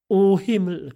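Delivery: loud, high cry of emotion; moved exclamation. A narrator's reading. Mother shaken by her daughter's words, moved exclamation. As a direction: cry out, deeply touched. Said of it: "rief sie".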